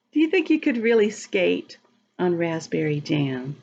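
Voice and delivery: ordinary delivery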